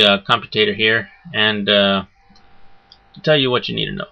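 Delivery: ordinary talk, nothing marked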